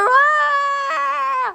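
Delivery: loud, high playful dinosaur roar; huge fiery dragon roar. Pretend. Mother voices toy dinosaur in play. (malakas na atungal ng dinosaur)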